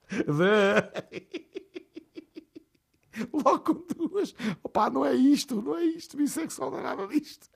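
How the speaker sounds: high, breaking into laughter mid-sentence; can't get the words out for laughing. Real, not acted. Host correcting the joke to listeners, laughing high.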